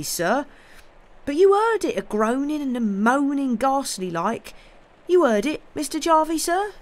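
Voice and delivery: high, loud, rustic servant pleading with his master; a scared country lad swearing he's sober; terrified servant begging his master to believe him; frightened old servant. Acted.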